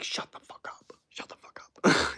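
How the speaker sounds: unvoiced mock-exasperated whisper; whispered retort. Streamer answering the shrieking YouTuber he just mimicked, in a whisper. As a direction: whisper it under your breath, repeating it. Mock reply to the squealed impression.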